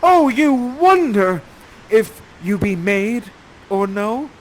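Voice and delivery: loud, high, slow proclamation; crying out in awe. Acted. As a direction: declaim it, dazzled by her. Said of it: "you cry out".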